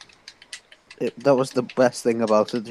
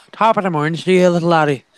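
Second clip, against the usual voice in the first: a hammed-up Irish accent, pitched higher and brighter in energy; 5 semitones higher, 4 dB louder than usual. Shown as a demonstration. Showing a friend what an Irish accent sounds like, in a hammed-up Irish accent. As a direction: play a jolly stage Irishman, greeting a little laddie in a broad Irish accent. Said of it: "Irish is like this".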